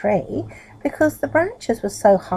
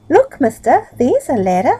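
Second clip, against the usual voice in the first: put-on naughty boy voice; 6 semitones higher, 7 dB louder than usual. Naughty boy calls out to Middle.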